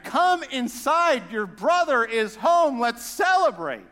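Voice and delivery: loud, slow, drawn-out plea; pleading father. Retold or imitated. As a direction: call him in, stretching every word. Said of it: "So the father's pleading".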